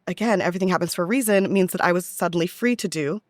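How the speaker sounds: usual speaking voice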